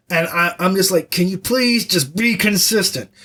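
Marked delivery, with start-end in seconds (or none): 1.1-3.1 pleading with emphasis, pitch lifted a little; exasperated plea. Acted. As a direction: beg for it in mild exasperation. Acted exasperated plea to the narrator.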